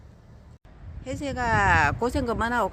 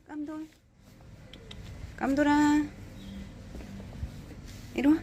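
calling out; 7 semitones higher, 3 dB quieter than usual. Real, not acted. Calling the animal over.

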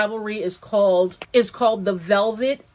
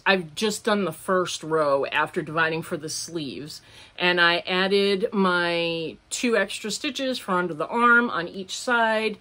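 ordinary talk

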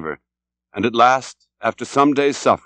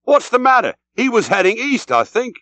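very high, bewildered exclamation; English captain, taken aback and high-pitched; startled cry; 11 semitones higher than usual. A narrator's reading. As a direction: answer in alarm, voice shooting up. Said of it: "cried the English captain".

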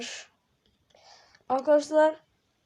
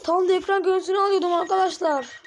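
frustrated outcry; 6 semitones higher, 4 dB louder, faster than usual. Real, not acted. Frustrated outburst at game.

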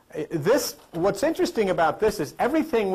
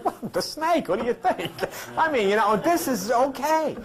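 mock innocent reply; 8 semitones higher than usual. Mock reply to God in Bible story.

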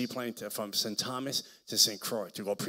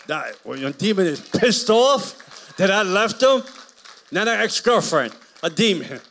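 high, loud, slow outburst; high, loud, drawn-out pulpit cry; preaching shout; 9 semitones higher, 15 dB louder, slower than usual. Real, not acted. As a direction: hammer the punchline home.

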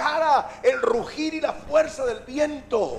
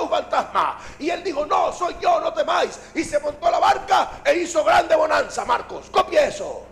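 high, loud dramatized storytelling; forceful preaching; 6 semitones higher, 6 dB louder than usual. Acted. Preacher acting Pedro dictating to Marcos, high forceful delivery. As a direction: belt out the tale to the whole congregation.

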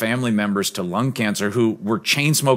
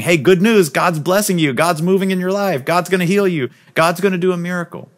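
much higher, louder, eager good-news call; higher and louder, the same people now urging him forward; excited crowd calling; 7 semitones higher, 3 dB louder than usual. Retold or imitated.

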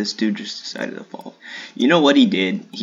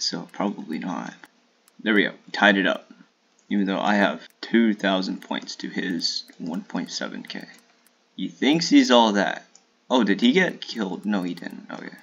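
ordinary talk, nothing marked

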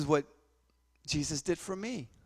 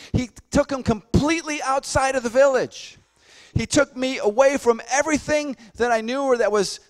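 loud, higher, driving preacher voice; full-force preaching; 7 semitones higher, 9 dB louder than usual. Acted. Pastor urging the church that Jesus wants them alone, full-force preaching. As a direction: preach it out hard to the congregation.

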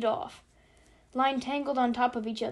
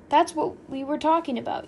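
higher, louder exclamation; crying out; 4 semitones higher, 5 dB louder than usual. A narrator's reading. Yossarian to the Chief on remembering, crying out. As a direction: exclaim it as a sudden recollection. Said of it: "Yossarian cried".

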